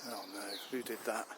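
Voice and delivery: ordinary talk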